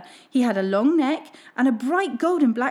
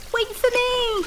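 much higher pleading call; eager duckling; 12 semitones higher, slower than usual. Acted. Duckling skittering after the swans, calling. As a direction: call out hurrying after them. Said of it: "he honked".

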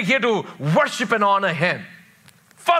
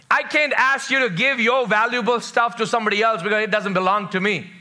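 ordinary talk, nothing marked

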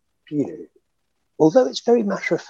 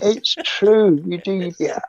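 higher, breaking into a laugh; laughing agreement; 9 semitones higher, slower than usual. Real, not acted. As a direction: agree while the laugh bubbles up.

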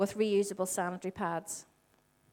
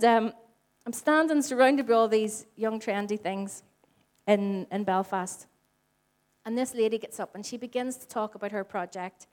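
ordinary talk

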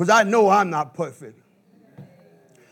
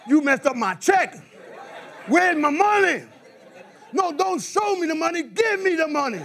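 mock angry demand; 8 semitones higher than usual. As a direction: confront the boss about the paycheck. Boss messed up paycheck.

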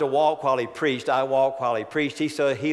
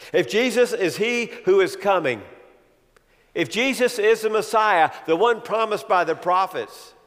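"if Jesus" hammered high and loud, building to the end; preaching at full force; 7 semitones higher, 6 dB louder than usual. Acted. Preacher building the congregation to hope, rising preaching voice. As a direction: climb with each if, then land it.

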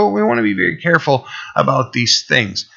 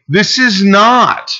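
higher, louder, slow and stressed; emphatic preaching; 8 semitones higher, 5 dB louder, slower than usual. Real, not acted. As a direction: pastor warns his listeners that the church is not a social club, and says it loudly and slowly. Pastor stresses a contrast.

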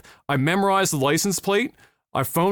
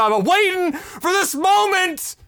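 mock tough guy; 10 semitones higher, slower than usual. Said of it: "I said".

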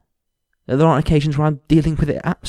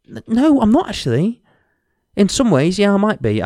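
heated denial; 9 semitones higher, 4 dB louder than usual. Real, not acted. Heated sudden retort.

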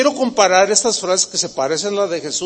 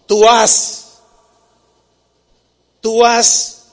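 "tú haz" más fuerte y un poco más agudo; tú haz con fuerza; 3 semitones higher, 6 dB louder, much slower than usual. Retold or imitated. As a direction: proclama la orden con fuerza. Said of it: "Y Jesús dice". (tú haz, proclamado con fuerza)

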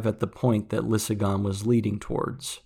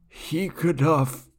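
put-on slow, higher old man's call; dying old man calling; 6 semitones higher, slower than usual. A narrator's reading. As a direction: reach out to the boy by name. Dying old man calls the boy.

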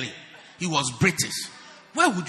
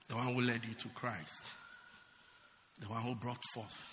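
calm teaching; 9 semitones lower, 15 dB quieter than usual. Acted. Quiet lecture-style teaching.

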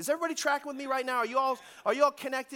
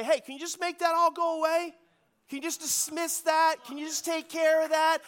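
mock plea; 6 semitones higher than usual. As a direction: ask God to make it go away like a favor. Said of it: "you can just say".

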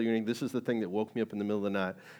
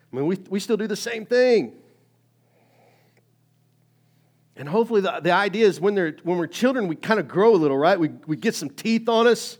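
loud, higher, driving sermon voice; preaching at full force; 7 semitones higher, 11 dB louder than usual. Acted. Pastor urging the congregation, loud preaching. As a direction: preach it out to the whole room.

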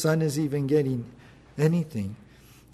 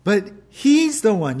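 accusatory; 5 semitones higher, 4 dB louder than usual. Acted. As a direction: drag it out, pointing at the brother. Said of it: "accusatory kind of tone".

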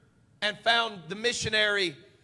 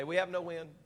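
quiet; 5 semitones lower, 11 dB quieter than usual. Real, not acted. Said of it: "Tell your neighbor today".

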